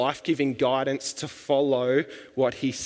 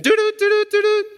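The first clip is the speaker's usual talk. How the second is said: loud, very high beeping doo-doo; timer going off; 6 dB louder, much faster than usual. Retold or imitated. Preacher mimicking a timer as Jesus' hour comes, high beeping noise. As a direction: imitate an alarm in a high, loud voice. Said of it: "the timer goes off".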